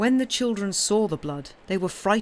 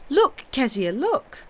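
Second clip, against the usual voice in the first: shrieked; 7 semitones higher, 4 dB louder than usual. A narrator's reading.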